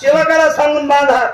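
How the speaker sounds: high pleading aunt's voice; aunt begging her brother to have her tied, high. Acted. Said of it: "आत्यानं सांगितलं".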